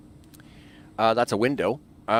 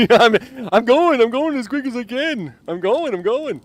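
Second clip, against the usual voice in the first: higher, flustered rushing; hurrying, flustered and laughing; 9 semitones higher, 5 dB louder than usual. Real, not acted. Hurried by the game.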